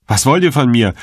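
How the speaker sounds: frightened call. A narrator's reading. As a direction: call out nervously, voice lifted. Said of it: "rief er schon von weitem".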